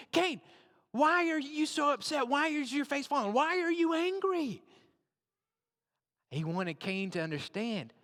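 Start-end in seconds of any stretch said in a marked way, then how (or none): 0.1-4.6 raised, pleading voice of God. Acted.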